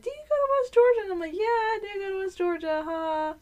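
high, put-on chirpy voice; mock small talk. Server mimicking small talk with restaurant customers, high and fake-sweet.

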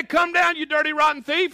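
loud, high, hurling each insult; scornful yell. Retold or imitated. What Jesus did not shout at Zacchaeus, a loud scornful yell. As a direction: shout down at him like a judge. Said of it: "he didn't say".

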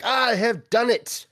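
high, slow, hammed-up gloating; mock villain triumph. Co-host voices the villain on the cover, mock triumphant. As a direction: proclaim it like a cartoon villain, drawn out. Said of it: "with his hands in the air".

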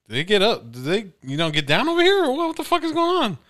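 shocked disbelief. Retold or imitated. Cousin in Mexico store, asking Adrian, shocked and high. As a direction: voice jumping way up in mock alarm. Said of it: "I'm like".

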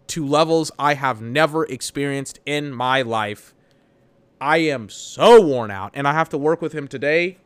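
usual speaking voice

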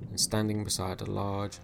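usual speaking voice

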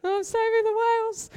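high, loud blubbering wail; a mock-tearful sob, high and strained. A woman blubbering to her husband about the whales, high and loud. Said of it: "I'm just like a blubbering mess".

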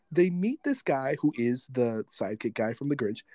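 usual speaking voice

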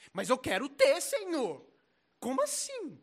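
high, loud mock whining; whiny protest. Envious believer protesting to the Lord, hammed-up whine. Acting envious person complaining to God.

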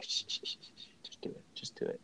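whispered shushes and urging; imitated hushed pressuring whisper; shushing. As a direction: hush and coax under your breath. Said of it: "he continues to shush me".

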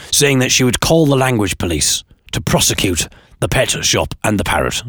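ordinary talk

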